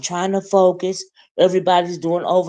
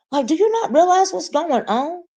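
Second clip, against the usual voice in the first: exasperated rant; 13 semitones higher than usual. Real, not acted. Ranting about interrupters.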